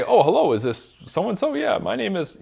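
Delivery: high, put-on formal phone greeting; stiff formal salesman. Shown as a demonstration. Sales rep stiffly greeting a stranger, high and formal. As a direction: sound like a robotic salesperson. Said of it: "you wouldn't say that".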